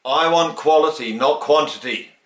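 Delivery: poor imitation of authority. Guru cutting off a protest, a poor imitation of firm authority. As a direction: hammer the rule home, a poor imitation. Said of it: "I can only do a very poor imitation".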